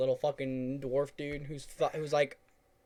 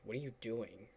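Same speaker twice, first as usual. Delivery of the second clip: quiet retold question; 9 dB quieter than usual. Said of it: "I'm like".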